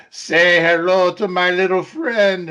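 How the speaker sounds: drawn-out movie tough-guy line; Al Pacino. Retold or imitated. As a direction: act out the climactic film line, stretched slow. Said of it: "and he goes".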